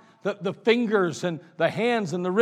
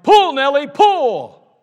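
loud, higher farmer's shout; farmer yelling; 7 semitones higher, 13 dB louder, much slower than usual. Acted. Farmer shouting at his horse to pull the car, loud. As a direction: bellow it at the horse. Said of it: "he yelled".

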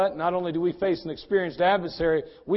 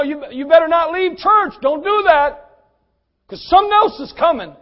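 much louder and higher urgent warning; full-force preaching; 8 semitones higher, 8 dB louder than usual. Acted. Preacher building to a shout.

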